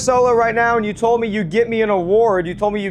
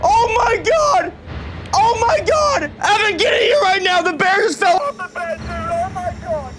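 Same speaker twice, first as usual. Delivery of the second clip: very high, frantic shouting; panicked screaming; 11 semitones higher than usual. Real, not acted. Ghost hunter calling Evan in as the bear falls, panicked screaming. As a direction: scream for help in real panic.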